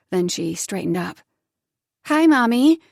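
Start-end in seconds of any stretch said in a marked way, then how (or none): 2.0-2.8 put-on higher, louder child's voice; little girl greeting. Daughter greeting mother, high child voice. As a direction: greet her like a small girl. Eva said.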